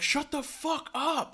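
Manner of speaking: high, drawn-out holler at normal volume; imitated yelling. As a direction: mimic the husband's stretched-out yell. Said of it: "he's just kinda yelling".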